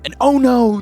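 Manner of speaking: spring the twist on the audience; dramatic oh no. Real, not acted. Dramatic turn in recap.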